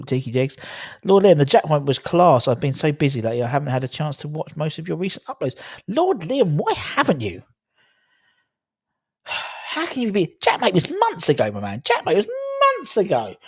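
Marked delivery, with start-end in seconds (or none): 5.9-13.4 high-pitched playful scolding; mock disbelief. Streamer chiding a viewer who missed his video, mock disbelief. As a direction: tease him with exaggerated, high incredulity.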